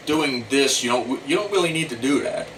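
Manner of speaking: ordinary talk, nothing marked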